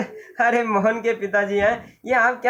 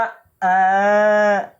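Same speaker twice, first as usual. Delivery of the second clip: tongue-out aah; 4 dB louder than usual. Acted. Mohan shows tongue to doctor.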